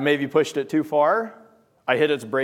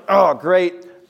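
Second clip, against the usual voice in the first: exasperated groan; 3 semitones higher, 6 dB louder than usual. Acted. Frustrated parent finds more chores.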